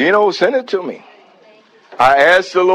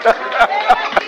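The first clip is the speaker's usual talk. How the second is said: ecstatic praise; 11 semitones higher than usual. Real, not acted. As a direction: let the Spirit lift you into shouted praise.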